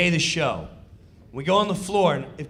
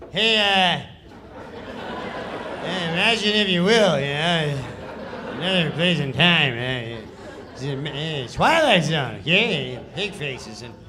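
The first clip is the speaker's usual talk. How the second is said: louder, offhand read with a laugh; Norm's lazy read; 3 dB louder than usual. Retold or imitated. Reading the Twilight Zone intro at the table, lazy and offhand. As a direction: toss the lines off, chuckling. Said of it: "this is how he reads the sketch".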